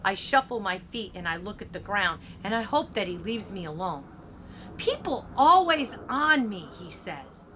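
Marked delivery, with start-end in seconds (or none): 4.8-6.7 high-pitched, heated grumble; defensive and worked up; aggrieved complaint. A narrator's reading. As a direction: complain that you're always picked on. Bully complains to narrator.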